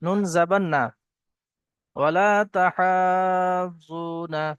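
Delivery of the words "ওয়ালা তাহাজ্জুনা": slow drawn-out melodic chant; Quran recitation. Acted. Teacher reciting the verse for students, slow melodic chant. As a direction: intone it slowly, stretching the vowels.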